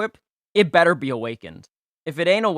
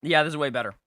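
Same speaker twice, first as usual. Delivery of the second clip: usual speaking voice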